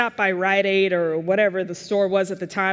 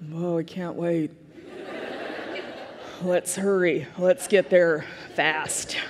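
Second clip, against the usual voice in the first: sarcastic enthusiasm; 2 semitones lower, 3 dB quieter, slower than usual. Retold or imitated. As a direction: feign excitement with no energy at all. Unimpressed reply to eager mother.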